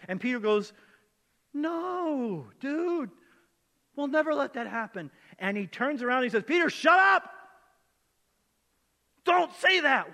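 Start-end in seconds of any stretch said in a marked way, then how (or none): 1.5-5.1 high, loud, drawn-out "no" of protest; loud, high-pitched objection acted out; alarmed protest. Acting out Peter's alarmed protest. 6.4-10.1 furious high shout; very loud, high-pitched outburst; angry rebuke. Acted. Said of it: "Why does he get so mad?".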